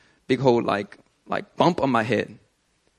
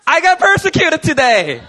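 high, loud laughing brag; mock triumphant, laughing; 14 semitones higher, 8 dB louder than usual. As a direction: crow about it with a laugh. Mock joyful quote.

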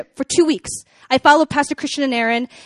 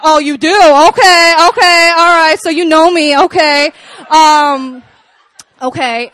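loud, challenging string of okays; mock indignation; 3 semitones higher, 10 dB louder, slower than usual. Woman replays retorting to her date, loud mock indignation. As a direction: belt it out in hammed-up disbelief.

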